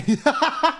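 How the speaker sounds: high-pitched burst of laughter. Real, not acted. Storyteller recalling protesting his accent is real, laughing. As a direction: break up laughing mid-story.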